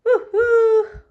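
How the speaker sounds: high, loud whooping cheer; excited whoop. Acted. Toy button cheering for the squirrels, loud high whoop. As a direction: let out a big happy woohoo.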